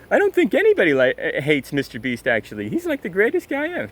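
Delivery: high, loud mock-gushing sarcasm; sarcastic praise. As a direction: gush over him with heavy sarcasm.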